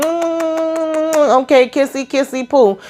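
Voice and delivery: high, drawn-out cooing hum; singsong mockery of smitten lovers; mock lovey-dovey. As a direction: tease with a high kissy-baby coo. Mocking a couple's honeymoon bliss.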